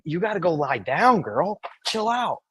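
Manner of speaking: normal talking voice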